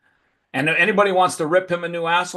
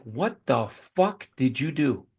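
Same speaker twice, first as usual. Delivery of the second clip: slow, drawn-out, softer disbelief; mock stunned question; retold disbelief; 4 dB quieter, slower than usual. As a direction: stretch it out, stunned. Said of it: "I'm like".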